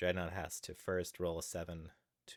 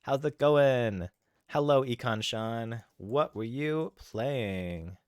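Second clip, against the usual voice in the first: excited hype; 6 semitones higher, 9 dB louder than usual. Real, not acted. Streamer hypes an incoming raid.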